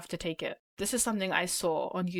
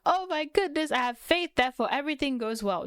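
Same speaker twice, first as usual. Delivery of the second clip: high, gushy mock optimism; exaggerated breezy faith, higher than usual; mock naive gushing; 7 semitones higher than usual. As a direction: gush it like a starry-eyed believer, pitched up.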